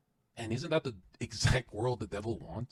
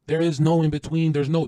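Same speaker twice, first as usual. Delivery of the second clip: shouting; 5 semitones higher, 10 dB louder than usual. Real, not acted. Hyped motivational yelling.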